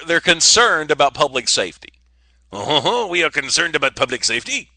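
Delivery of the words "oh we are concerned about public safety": hammed-up French accent; stagey French accent. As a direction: put on your best mock Frenchman, lilting up at the end. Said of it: "the best French accent I could do".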